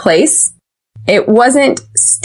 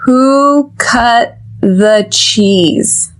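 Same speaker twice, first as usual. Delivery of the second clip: very slow, word by word; slow-motion model; much slower than usual. Shown as a demonstration. Teacher leading a listen and repeat exercise, very slowly. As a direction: stretch out each word for learners to copy. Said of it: "So repeat after me".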